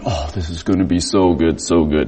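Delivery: low, soft hushed anticipation; quiet mock drool; eager inner thought. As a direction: savor it under the breath.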